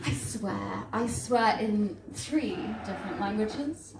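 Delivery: ordinary talk, nothing marked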